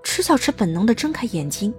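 ordinary delivery

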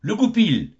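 a high, loud cry at spotting the fox; shout of discovery. Acted. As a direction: yell as the fox comes into sight. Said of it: "Tous s'écrient".

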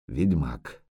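low, soft dread-filled murmur; hushed dread. Acted. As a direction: breathe the word in fear. Yurga's dread thought.

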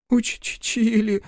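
very slow, high sniffle; sniffling boy. A narrator's reading. Scolded boy admits to Рух with a high sniffle. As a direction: sniff it out sheepishly. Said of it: "шмыгнул носом Гришка".

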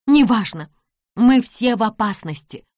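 loud, urgent exclamation; exclaimed. A narrator's reading. Nancy warns everyone of danger, exclaiming. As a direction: brush it off and sound the alarm. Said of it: "воскликнула Нэнси".

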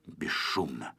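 usual speaking voice

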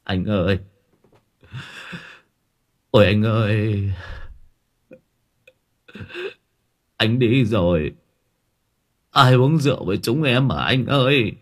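lightly acted drunken wake keening; mournful. A narrator's reading. Brother addresses the dead man at his coffin, mournful. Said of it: "giọng thảm thương".

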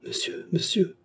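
high, whispered pleading; hushed, high imploring plea. A narrator's reading. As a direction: beg the judge under your breath. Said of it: "supplia Fernand".

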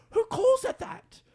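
high, slow incredulous squeal. Retold or imitated. Host recalling watching the rapper's video, incredulous squeal. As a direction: squeak it out in disbelief. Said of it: "I was like".

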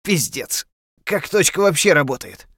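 high, loud disbelieving cry; shocked outburst. Acted. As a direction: yell it in stunned disbelief. Companion shocked after crash landing.

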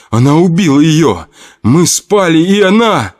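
high sobbing cry. Acted. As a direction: choke the words out through tears. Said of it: "сел на ступеньки и зарыдал".

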